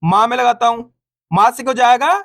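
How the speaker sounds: ordinary talk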